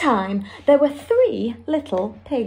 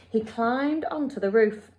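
plain reading voice

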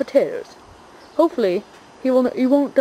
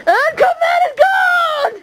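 much higher, slow triumphant yell; big announcer shout; slower than usual. Real, not acted. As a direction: announce the exit like a showman. Final stunt announcement.

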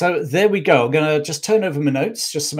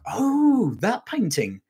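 sudden high "Oh" of dawning recognition; mock realisation, pitch leaping up on "Oh"; mock surprise; 9 semitones higher, much slower than usual. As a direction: play the audience's pretend surprise. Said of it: "a lot of you would be like".

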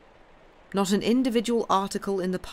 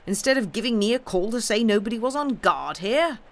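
louder, raised and indignant; foaming anger; 5 semitones higher, 4 dB louder than usual. A narrator's reading. Captain accusing Sam over the unguarded box, furious. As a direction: spit out the accusation. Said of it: "foamed the captain".

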